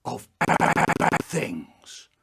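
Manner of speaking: slow, dark and drawn out; slow spooky emphasis; ominous. Acted. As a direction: make it sound sinister.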